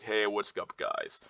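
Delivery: falling, subdued end on "guys"; downward inflection. Shown as a demonstration. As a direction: let the tune sink down to GUYS. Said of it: "or the downward inflection of like".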